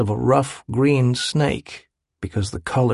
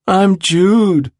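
high, loud slurred mocking voice; cruel slurred parody; 8 semitones higher, 5 dB louder than usual. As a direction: slur it like a cruel mimic. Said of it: "he slurred".

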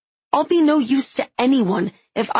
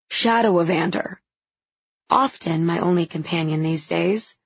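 ordinary delivery, nothing marked

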